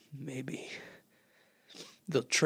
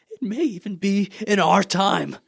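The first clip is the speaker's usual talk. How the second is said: eager rising voice; 6 semitones higher, 6 dB louder than usual. Acted. Artilleryman imagines seizing machines.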